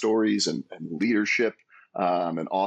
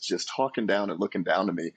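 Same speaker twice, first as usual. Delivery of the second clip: ordinary talk, nothing marked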